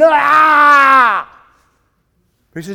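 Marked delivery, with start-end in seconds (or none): 0.0-1.3 loud, high "ah!" of strain; strained yell. Acted. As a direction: yell as if gritting through it. Acts straining through a trial.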